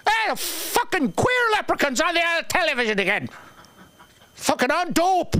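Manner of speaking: high, hammed-up grumpy Irish dad; imitating dad. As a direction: bark it up high like an old cranky father. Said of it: "You hear my dad go".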